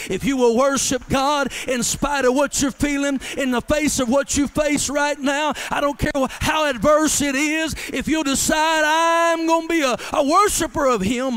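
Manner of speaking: loud, rising preacher's shout; preaching at full force. Acted. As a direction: build the worship call to a full shout.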